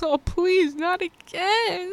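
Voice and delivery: high, pained plea, drawn out slowly; pleading not again. Retold or imitated. Movie fan recalling her reaction in the theater, pained plea. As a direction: beg the film not to do it again.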